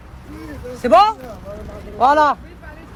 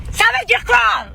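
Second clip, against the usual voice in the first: real indignation, voice raised; outraged; 8 semitones higher, slower than usual. Real, not acted. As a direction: demand it indignantly, pitch jumping up. Outraged question about child victims.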